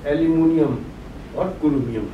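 ordinary talk, nothing marked

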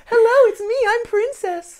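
very high, airy princess voice; sweet storybook princess, high. Shown as a demonstration. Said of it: "my princess voice when I put Holly to bed".

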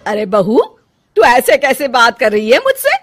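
loud, high, defiant snapping at the mother-in-law; angry retort. Acted. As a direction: talk back hotly. Daughter-in-law quarrels with mother-in-law. (गुस्से में बहू सास को जवाब देती है)

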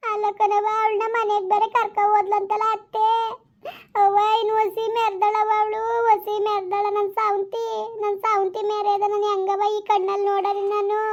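keen it like a folk dirge to her mother; high, drawn-out crying complaint; keening daughter. Acted. Daughter keens about the rival.

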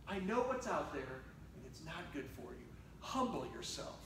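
low, hushed counsel; quiet, low voice of fatherly authority; gentle fatherly warning. Acted. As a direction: drop down soft and grave to warn him. God gently warns the preacher.

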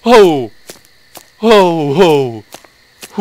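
loud high panicked no no; frantic scared shouts; terrified yelling. Real, not acted. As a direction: cry out in real fear. Chased by monster.

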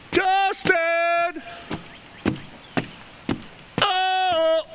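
high calls after the dogs; calling out. Real, not acted. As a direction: call them back from a distance.

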